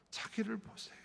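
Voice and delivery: whispered, intimate command. Real, not acted. Preacher whispering to the congregation to look at themselves. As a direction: lean in and whisper it. Sermon climax, intimate hush.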